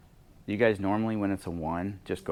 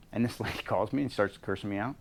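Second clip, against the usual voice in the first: ordinary talk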